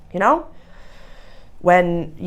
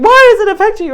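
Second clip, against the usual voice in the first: sharp high mocking ask, much louder; accusing tone; 9 dB louder, faster than usual. Shown as a demonstration. To her birth father, the accusing way she did not ask it. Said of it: "I wasn't saying".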